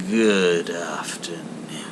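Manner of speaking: put-on cold old miser, slow; slow curt Scrooge. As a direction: drag it out, end the talk. Scrooge repeats, colder.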